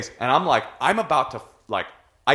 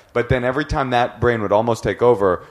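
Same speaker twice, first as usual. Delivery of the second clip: normal talking voice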